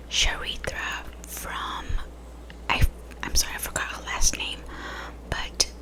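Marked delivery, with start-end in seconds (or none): none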